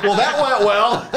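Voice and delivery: high, slow punchline through laughter; laughing. Real, not acted. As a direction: deliver the wry line while cracking up.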